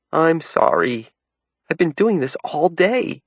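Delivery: apologizing through tears; mild tearful voice; sobbing. A narrator's reading. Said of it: "started to sob".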